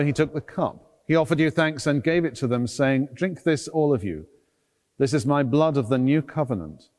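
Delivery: ordinary talk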